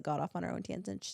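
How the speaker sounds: ordinary delivery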